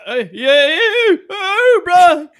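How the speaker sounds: very high, loud hollering; hammed-up cheer; mock country whooping.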